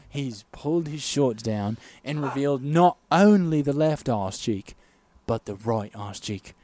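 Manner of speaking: low, measured British commentary; grave wind-down after the climax; British sports commentator. Acted. Commentator closing out the Olympic chug, measured British voice. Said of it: "I'll commentate the whole bit".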